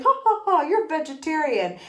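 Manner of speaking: put-on, high-pitched social amusement; fake laughing guests. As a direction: imitate superficial dinner guests laughing. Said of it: "so they're all laughing".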